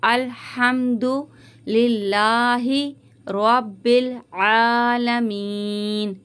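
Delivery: slow Quranic recitation, आलमीन drawn out at the end; tajweed recitation. Shown as a demonstration. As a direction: chant अल्हम्दुलिल्लाहि रब्बिल आलमीन with a stretched मीन. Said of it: "आ को हल्का सा खींचेंगे और मीन को जो है खींचते हुए रुकेंगे". (धीमी तिलावत, आलमीन को खींचकर)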